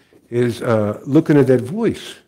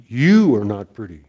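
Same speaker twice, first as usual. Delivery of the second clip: YOU stressed strongly, the rest dropping away; 3 dB louder than usual. Retold or imitated. Grandfather shows granddaughter the voice isn't her, stress on YOU. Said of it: "uses the generic or the royal you".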